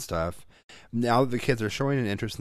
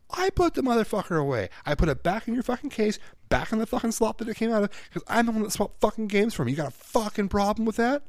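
high-pitched furious tirade, retold at full tilt; angry telling-off; 13 semitones higher than usual. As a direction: hammer home each point, fed up. Re-enacts scolding the boy.

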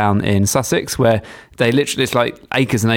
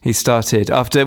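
normal talking voice

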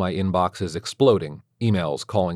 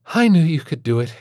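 higher and louder, chirpy encouragement; bright teacher praise; 9 semitones higher, 7 dB louder than usual. A narrator's reading. Teacher congratulating the father, higher and louder. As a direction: cheer him on like a kindergarten teacher.

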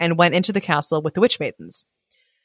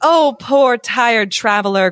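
slow, coaxing maiden voice; sweet witch maiden; 5 semitones higher, 3 dB louder, slower than usual. A narrator's reading. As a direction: lure him in sweetly. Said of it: "cried the witch maidens".

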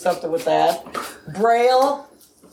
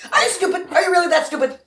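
laughing shout; 9 semitones higher, faster than usual. Real, not acted. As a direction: yell it in disbelief, laughing. Mocking a card choice.

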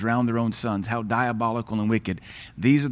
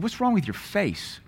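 retold puzzled question; 8 semitones higher than usual. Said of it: "I said".

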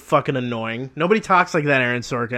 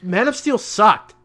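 angry rant; 7 semitones higher, 6 dB louder, slower than usual. Real, not acted. Ranting about the film.

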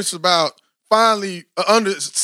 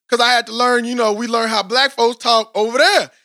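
normal talking voice

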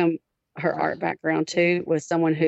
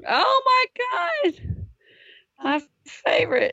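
delighted squeal. Real, not acted. Delighted reaction to tomato.

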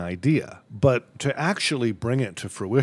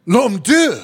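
loud French exclamation, pitched up; French accent; 9 semitones higher, 9 dB louder than usual. Pretend. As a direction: shout it like a startled Frenchman. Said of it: "he just went".

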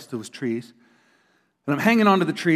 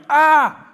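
high, loud startled yell; recalling a shock at a garter snake; retold scream; 13 semitones higher, 9 dB louder than usual. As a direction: yell at a snake right in your face. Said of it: "All I remember is going".

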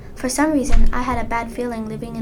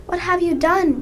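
high, loud angry yell; furious shout; 7 semitones higher, 8 dB louder than usual. Acted. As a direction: shout it in a rage. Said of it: "he shouted".

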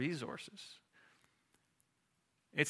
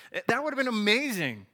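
louder, higher, rising enthusiasm; excited build-up; 7 semitones higher, 11 dB louder than usual. Real, not acted. Preacher pictures God's flashy victories for the church, higher and louder with excitement. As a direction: sell the spectacle with rising energy. Preacher hyping imagined miracles.

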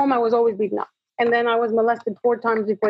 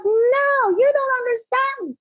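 high-pitched retold yell, drawn out; 13 semitones higher, slower than usual. Distraught woman to the speaker calming her down, imitated high yell. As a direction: mimic a desperate cry of protest, pitch soaring. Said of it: "She yells at me".

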